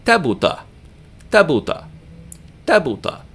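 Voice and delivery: Tabuta in three quick, even short vowels; three short syllables. Shown as a demonstration. Teacher demonstrating three short syllables, brief tabuta. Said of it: "here we have three short syllables".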